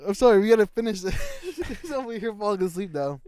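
loud, high, helpless laughter in the words; laughing. Real, not acted. Apologising that he is falling asleep, loud laughing.

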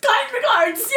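high, loud, gleeful email sign-off; mock-professional sign-off. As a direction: trumpet it like a triumphant formal farewell.

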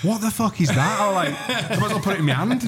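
mock outrage. Retelling his own outraged reply.

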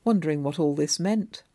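usual speaking voice

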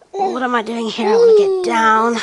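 put-on rising whiny baby voice; mother voicing baby's complaint. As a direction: whine as the wriggly baby. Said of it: "Sometimes he's like".

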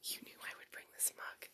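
hushed aside, barely voiced; whisper. Real, not acted. Host whispering to her cohost about the mug. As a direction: lean in and whisper it conspiratorially.

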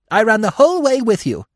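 high, loud squirrel voice; excited cry. A narrator's reading. Squirrel chattering to the fleeing deer, excited cry. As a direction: cry out shrilly in agitation. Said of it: "he cried".

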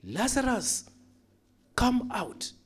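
high-pitched commanding call; high, ringing summons. Acted. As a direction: summon someone from afar, high and drawn up. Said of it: "he called out and says".

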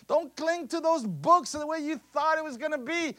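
loud, high, building sermon cry; Jesus proclaiming, preached loud and high; preaching at full force. Acted. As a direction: preach it like the climax.

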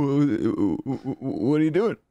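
high, stuttered what; stammering high voice. Pretend. Repeating the stammer bit.

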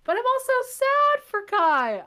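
hammed-up despair for Kai; mock wail. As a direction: wail it like an overwhelmed fan. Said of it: "I am all the feelings".

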